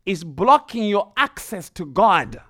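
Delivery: rising, forceful sermon delivery; preaching. Acted. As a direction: press the point home to the congregation.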